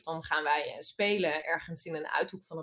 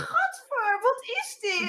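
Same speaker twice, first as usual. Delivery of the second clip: put-on high, whiny kid's yuck with a whimper; a toddler recoiling from its plate; child whining in disgust; 3 dB louder, slower than usual. Child refusing vegetables.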